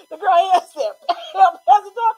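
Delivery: laughing loudly through the story; laughing retelling. Real, not acted. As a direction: crack up while telling it. Retelling slap in hallway, laughing.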